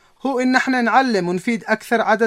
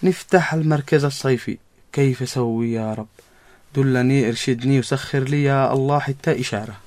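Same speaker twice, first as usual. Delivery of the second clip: prayer; 8 semitones lower than usual. Acted. Lone prayer to God.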